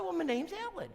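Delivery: put-on high, sweet older lady voice; preacher voicing the visitor Ellen, high and sweet. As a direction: play her as a sweet lady.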